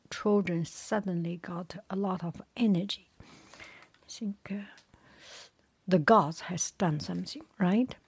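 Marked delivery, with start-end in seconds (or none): none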